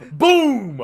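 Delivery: shouted boom sound effect; loud boom. Acted. Sound effect of bright light.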